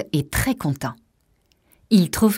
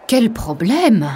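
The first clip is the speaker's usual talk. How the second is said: higher, louder, drawn-out exclamation; dismayed exclamation; 5 semitones higher, 5 dB louder, much slower than usual. A narrator's reading. Mother hears a lead actor is ill, dismayed exclamation. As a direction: exclaim slowly at the setback, voice raised.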